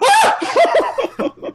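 high, laughing yelp; a burst of overwhelmed joy; overwhelmed cry. Retold or imitated. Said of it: "I, like, cried".

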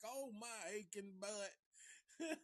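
put-on high, slow, creaky old-man groan; laughing old man. The old man laughing at Hopper's swings, high slow groan. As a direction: moan it like a giggling grandpa. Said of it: "He's like".

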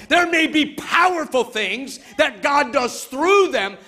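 loud, high preacher's build; preaching at full force. Acted. Pastor preaching to mothers, loud and rising. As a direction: preach it out to the whole church.

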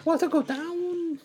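cartoon voice. Cartoon voice quote.

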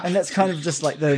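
ordinary delivery

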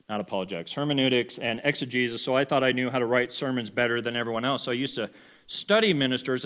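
normal talking voice

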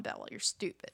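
hushed mock-condescension; whispered put-down. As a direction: breathe it out as a snide aside. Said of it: "he's like".

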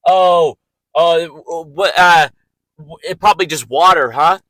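loud, fast incredulous audience voice; a sceptic scoffing in disbelief; doubting moviegoer. Pretend. Imagined doubting audience member.

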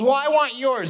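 put-on high, insistent little girl. Little girl demanding a boy's scooter, high and insistent. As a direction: demand it like a spoilt child. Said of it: "She goes".

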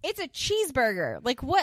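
loud, high-pitched indignation; incredulous. Real, not acted. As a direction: exclaim in comic outrage at the absurdity. Indignant at child refusing food.

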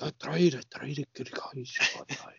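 imitating a desperate whisper; hushed pleading whisper; whisper impression. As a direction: breathe it out in a whisper. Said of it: "He's like".

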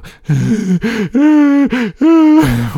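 loud high whining noise; imitated wail. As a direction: mimic the game's wailing. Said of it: "and it would go".

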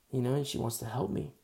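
ordinary talk

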